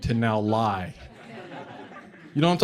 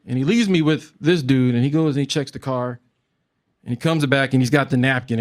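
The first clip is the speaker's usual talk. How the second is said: ordinary talk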